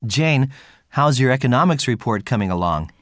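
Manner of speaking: ordinary talk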